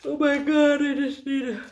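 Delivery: words stretched by a real yawn. Real, not acted. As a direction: caught mid-yawn while talking. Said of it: "watching me yawn".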